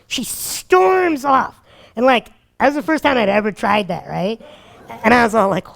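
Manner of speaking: ordinary talk, nothing marked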